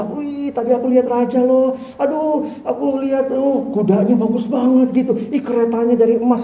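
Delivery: put-on high, eager kid's chatter; breathless kid telling friends; excited child. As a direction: gush like a child who saw the king. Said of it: "anak-anak ... cerita".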